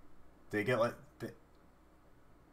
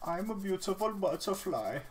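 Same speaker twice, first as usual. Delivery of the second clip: high, put-on Swedish accent; a silly Swedish accent voice; 11 semitones higher than usual. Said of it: "German accent or ... a Swedish accent".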